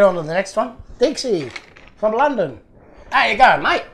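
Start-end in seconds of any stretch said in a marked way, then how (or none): 3.1-3.8 higher, louder attempt at an English accent. Shown as a demonstration. Toy collector greeting the London sender, badly faked English accent. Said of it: "gonna do an English accent".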